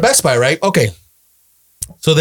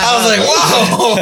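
higher, slow drawn-out whoa; stunned whoa; 5 semitones higher, slower than usual. Retold or imitated. Uncle hearing nephew ask for phone, slow stunned whoa. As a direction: let the surprise stretch out.

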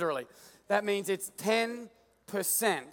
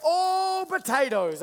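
higher, louder, slow drawn-out marvel at the potatoes; delighted gasp; 8 semitones higher, 5 dB louder, much slower than usual. Retold or imitated. Farmer marvels at his first potato harvest, higher and louder. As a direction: savour the words with delight. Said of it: "I'm like".

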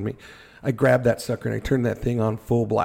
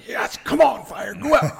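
excited cheer; 11 semitones higher, 3 dB louder than usual. Retold or imitated. Adrenaline, cheering himself on.

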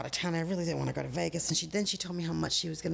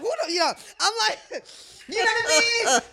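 much higher and louder, laughing through it; 11 semitones higher, 8 dB louder, much faster than usual. Real, not acted. Telling the hosts her story, laughing hard. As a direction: laugh the words out, high and loud.

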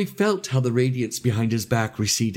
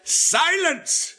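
very high-pitched commanding cry; sudden bellow. A narrator's reading. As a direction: cut in with a bellowed order. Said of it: "suddenly bellowed with an extraordinary force".

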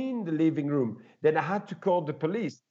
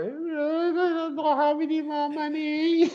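whiny plea; 13 semitones higher, slower than usual. Retold or imitated. Whining to Brian after breakup.

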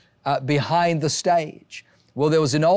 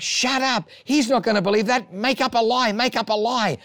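high urgent inner voice; the panicky part of his brain pushing a lie; 8 semitones higher than usual. Retold or imitated. His inner voice urging him to lie, high and urgent. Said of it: "other part of my brain's like".

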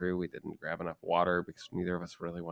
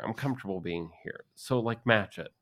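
ordinary talk

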